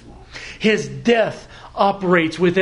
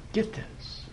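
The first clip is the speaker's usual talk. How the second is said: whispered aside; 2 semitones higher, 15 dB quieter than usual. Acted. Preacher to congregation, hushed whispered aside. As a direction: lean in and whisper it. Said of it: "aside to congregation".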